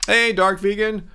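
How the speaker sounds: usual speaking voice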